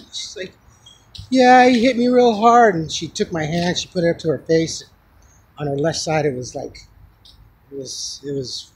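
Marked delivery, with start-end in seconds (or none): 1.3-2.7 high, loud retold complaint; imitating upset woman. Hit woman admits who did it, imitated distress. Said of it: "She was crying up a storm".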